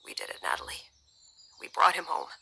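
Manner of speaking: hushed relief. A narrator's reading. Father to wife over rescued son, hushed relief. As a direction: breathe it out softly.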